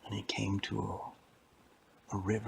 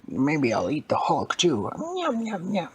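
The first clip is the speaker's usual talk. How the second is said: high, loud gobbling villain; greedy old lady; 8 semitones higher, 6 dB louder than usual. Acted. Old lady plans to eat the Hulk too, gloating. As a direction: gloat hungrily, climbing into falsetto. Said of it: "And she said".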